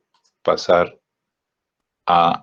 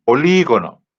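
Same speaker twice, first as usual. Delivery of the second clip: Polígono with the í punched higher and louder; stress on the í; 4 semitones higher, 5 dB louder than usual. Shown as a demonstration. Teacher showing students the written accent, stressing the í in Polígono. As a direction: lean hard on the accented í in Polígono. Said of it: "Lleva acento en la I".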